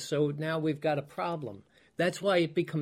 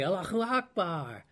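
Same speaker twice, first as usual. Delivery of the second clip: soft, glad "Allahu Akbar" like thanks for a gift; a quiet, happy "Allahu Akbar" of thanks; joyful Allahu Akbar; 4 dB quieter than usual. Retold or imitated. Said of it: "used as an expression of joy".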